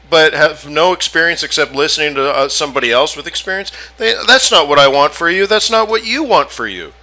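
ordinary delivery

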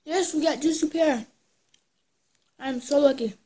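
pleased little victory cheer; excited. Real, not acted. Gets the flavor she hoped.

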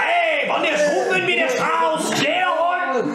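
high, loud rowdy god; booming spirit voice. Acted. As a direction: shout a drunken toast. Spirit god toasts, shouting.

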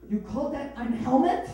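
put-on belittling German-accented question; scornful German. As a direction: cut him down in a German accent. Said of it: "She'll also emasculate any man".

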